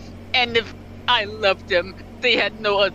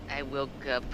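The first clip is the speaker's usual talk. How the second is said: hushed narrator; 6 semitones lower, 10 dB quieter than usual. Acted. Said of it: "do the point of view".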